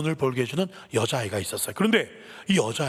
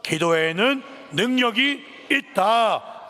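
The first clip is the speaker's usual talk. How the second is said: slow, raised, drawn-out declaration; read-along proclamation; 7 semitones higher, 3 dB louder, much slower than usual. Real, not acted. Preacher leading everyone to read together, slow proclamation. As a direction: proclaim it slowly, stretching each phrase. Leads congregation in reading aloud.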